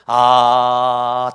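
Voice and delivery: loud drawn-out 아 of grief; loud lamenting sigh. Shown as a demonstration. Preacher showing James's lament to the church with a loud sigh. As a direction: let out a groan of lament. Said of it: "탄식하는 거죠".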